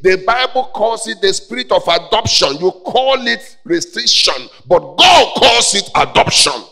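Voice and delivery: heated preaching. Real, not acted. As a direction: build the rebuke to a loud, high climax. Preaches at full force.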